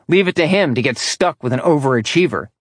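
usual speaking voice

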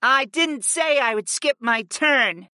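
high, slow, irritated growl; growled retort. A narrator's reading. Fi refuses to give up her turn, growling. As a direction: retort crossly in a high, drawn-out voice. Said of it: "Fi growled".

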